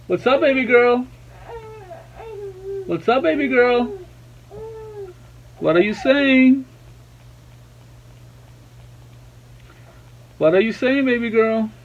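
high-pitched, cooing daddy questions. Real, not acted. Dad answers babbling baby girl, high baby talk. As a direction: coo up high to a babbling infant.

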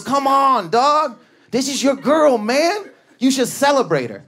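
high, loud smooth-talking comic. Retold or imitated. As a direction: coax him easy and slick. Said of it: "smooth, he goes".

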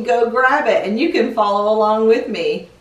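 ordinary delivery

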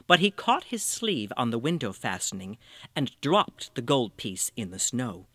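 ordinary delivery, nothing marked

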